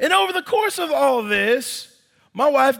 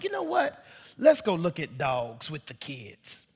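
sweet wife; 7 semitones lower, 8 dB quieter than usual. Retold or imitated. Wife's suggestion voiced.

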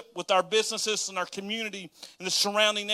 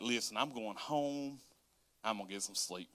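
low tired mumble; 8 semitones lower, 12 dB quieter than usual. Retold or imitated. Tired self-talk, deciding.

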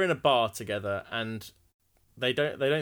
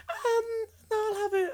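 high whining dither; whiny fluster. Acted. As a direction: dither in a whine. Flustered order, cry heard.

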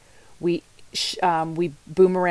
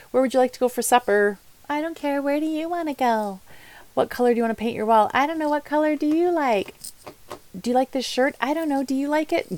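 higher, hammed-up dithering back and forth; indecisive people pleaser; 7 semitones higher, faster than usual. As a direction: play the people pleaser who never picks. Acting out indecisive supper chat.

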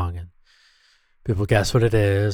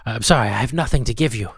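normal talking voice

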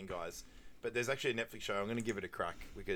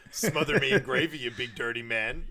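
mock flirty shout; 2 semitones higher, 7 dB louder than usual. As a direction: yell it like a cheeky come-on. Retelling a movie quote exchange.

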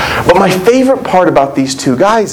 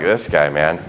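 mock know-it-all grumble; smug clueless disciple; 11 semitones lower than usual. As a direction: scoff at him like he's the dim one. Disciples mocking Jesus as clueless.

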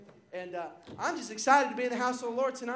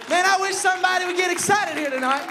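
preaching at full force; 7 semitones higher, 10 dB louder than usual. Real, not acted. Pastor urging the congregation to praise, loud preaching. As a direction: rouse the church to praise.

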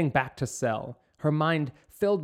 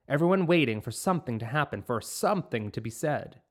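ordinary delivery

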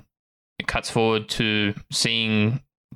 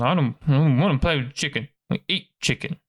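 higher, sulky little creature voice; small-voiced sulking pup; grumpy pup; 5 semitones higher than usual. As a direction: grumble like a reluctant pup. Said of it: "get a little grumpy, put his head down".

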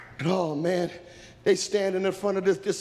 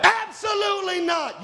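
high, shouted refusal; forceful pulpit shout; emphatic preaching; 14 semitones higher, 4 dB louder than usual. Real, not acted. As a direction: cry it out as a firm verdict. Preacher answers own question emphatically.

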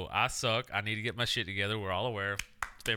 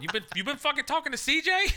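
much higher, outraged disbelief; shocked outburst; 4 dB louder than usual. Retold or imitated. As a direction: squeal it like you just caught them. Shocked reply to Raven.

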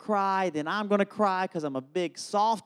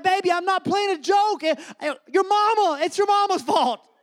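very high, hammed-up pleading; panicked denial; 11 semitones higher than usual. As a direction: squeal in self-defence like a cornered dad. Panicked dad shifts the blame.

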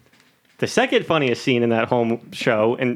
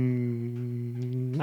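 long, soft hum into the mic; humming; 4 semitones lower, 11 dB quieter than usual. Real, not acted. Speaker stalls mid-thought by humming into the microphone, humming. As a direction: hum one held note, very quietly. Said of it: "Started humming into the microphone".

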